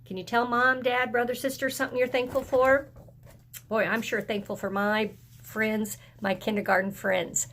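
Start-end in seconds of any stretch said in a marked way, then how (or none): none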